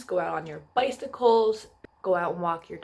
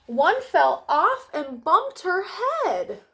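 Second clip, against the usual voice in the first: dramatic storytelling; 7 semitones higher, slower than usual. A narrator's reading. Dramatic fall line read to kids.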